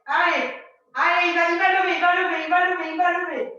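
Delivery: loud, insistent encouragement; urging cheer. Real, not acted. Coach urging a student who will lift, loud and insistent.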